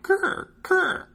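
very high, squawked bird croak; two short high-pitched raven cries; raven caw. A narrator's reading. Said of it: "pressed the raven's head so that it cawed".

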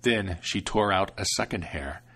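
ordinary delivery, nothing marked

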